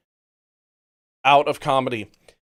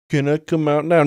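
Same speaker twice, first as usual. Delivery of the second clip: mock timid voice. Mocking a man sent to corner.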